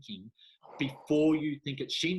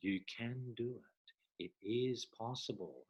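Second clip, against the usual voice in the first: much softer, hushed soothing affirmations; hypnotic soothing voice; 3 semitones lower, 13 dB quieter than usual. Retold or imitated. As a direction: lull the listener with soft encouragement. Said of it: "her beautiful hypnotic voice".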